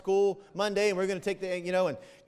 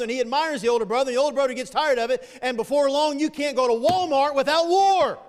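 higher, driving sermon delivery; impassioned preaching; 6 semitones higher, 7 dB louder than usual. Real, not acted. Preacher telling the congregation about brothers at war, higher and forceful. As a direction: build the frustration to a loud punch.

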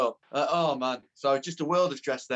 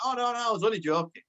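lightly imitated caller backtracking, pitched up; higher-voiced hasty take-back on the phone; Jimmy Bullard; 7 semitones higher than usual. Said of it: "this voice on phone".

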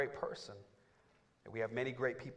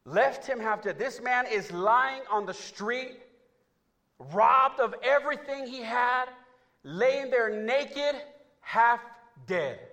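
high, loud, slow preacher's cry; full-force sermon shout; preaching shout; 12 semitones higher, 15 dB louder, slower than usual. Acted. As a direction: hammer home the man left half dead.

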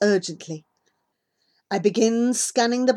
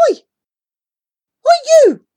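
high, very loud challenge; angry shout; 13 dB louder, much faster than usual. A narrator's reading. Boy calling out a suspected goat thief, angry shout. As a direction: holler "oi" at a thief.

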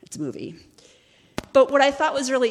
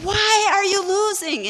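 very high-pitched, loud incredulity; baffled yell; 7 dB louder than usual. Retold or imitated. Friend asking the jouster why he loses, baffled yell. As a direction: wail the question in disbelief.

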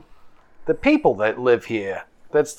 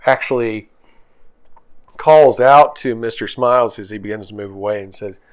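normal talking voice

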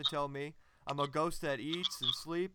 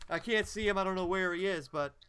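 usual speaking voice

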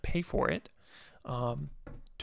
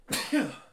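sharp real sneeze; sneezing; 9 semitones higher, 4 dB louder than usual. Real, not acted. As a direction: let a sudden sneeze burst out. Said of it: "Nope, right now".

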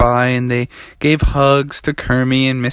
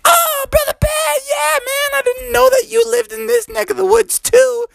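very high, excited squeaky frog; Little Froggy. Acted. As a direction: greet a friend in a tiny high voice. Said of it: "he was like".

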